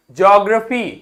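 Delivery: ordinary talk, nothing marked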